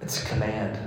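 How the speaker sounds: ordinary talk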